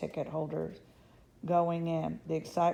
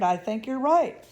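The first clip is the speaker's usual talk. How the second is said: surprised; 6 semitones higher, 8 dB louder than usual. A narrator's reading. Mr Bucket realises date.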